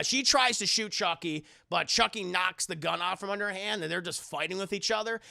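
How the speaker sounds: ordinary delivery, nothing marked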